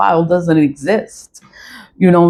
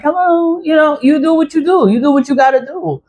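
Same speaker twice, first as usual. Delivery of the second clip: high-pitched job voice, much higher than usual; 10 semitones higher than usual. Shown as a demonstration. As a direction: chirp it high, like a put-on work voice.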